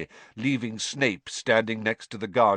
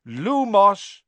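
spell incantation; 11 semitones higher, 8 dB louder than usual. A narrator's reading. Casting a spell.

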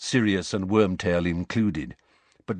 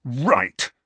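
sharper angry outburst; open fury; 2 semitones higher than usual. A narrator's reading. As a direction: let the fury break out on one word. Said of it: "who looked furious now".

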